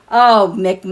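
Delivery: usual speaking voice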